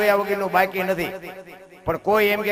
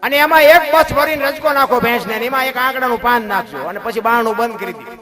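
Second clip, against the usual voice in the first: higher, much louder sermon delivery; preacher telling the buffalo parable at full voice; full-force preaching; 6 semitones higher, 10 dB louder than usual. Acted. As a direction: drive the parable home loudly.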